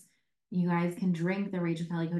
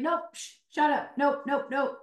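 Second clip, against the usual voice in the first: playful shushing; 6 semitones higher than usual. Retold or imitated. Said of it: "and I was like".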